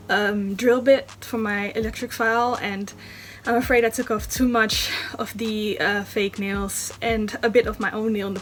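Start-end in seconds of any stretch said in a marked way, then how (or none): none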